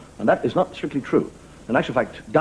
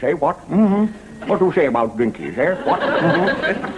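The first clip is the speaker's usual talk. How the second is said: swooping high-to-normal British officer caricature; hesitant, chummy colonel with little eh-whats; hammed-up CO welcoming a new lieutenant; commanding officer; 5 semitones higher than usual. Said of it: "people who talk like that".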